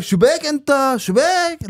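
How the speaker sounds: high, drawn-out Arabic exclamation; animated cousins impression. Showing how his cousins talk, animated and high. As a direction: mimic an excitable cousin, stretching the word up high. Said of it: "they're very animated".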